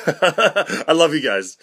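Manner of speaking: slow, laughing through the words. Pretend. Telling his parents he loves them, laughing.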